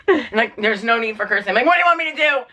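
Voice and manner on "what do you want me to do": high-pitched exasperated outburst; worked-up protest, acted out; retold yelling. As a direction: re-enact yelling in pain, pitch shooting up. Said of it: "I'm yelling at the dog".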